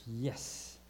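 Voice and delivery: ordinary delivery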